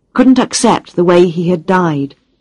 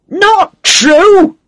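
very high incredulous scream; furious scream; 13 semitones higher, 5 dB louder than usual. A narrator's reading. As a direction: scream it in disbelief. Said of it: "screamed Balzano".

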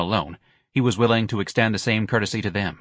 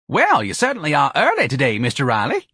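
higher-pitched, polite office manager; chirpy, deferential clerk; timid office manager; 5 semitones higher, 4 dB louder than usual. Acted. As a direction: greet a difficult employee in a higher voice.

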